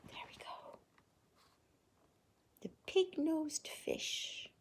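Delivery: whispering. Acted. Hushed storytelling.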